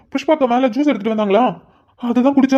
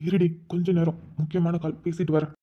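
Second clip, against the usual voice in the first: low, much softer aside to the wife; hushed brush-off; 6 semitones lower, 10 dB quieter than usual. Acted. Husband on a call waves off his wife, quiet and low. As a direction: hush her while the caller waits.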